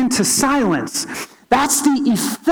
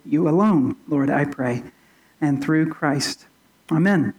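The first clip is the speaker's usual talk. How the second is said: opening prayer; 5 semitones lower than usual. Acted.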